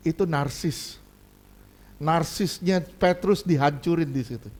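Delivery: normal talking voice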